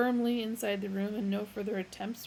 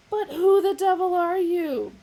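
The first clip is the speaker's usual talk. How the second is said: very loud, much higher; outraged; 10 semitones higher, 12 dB louder than usual. A narrator's reading. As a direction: explode with it. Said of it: "exclaimed an outraged Fook".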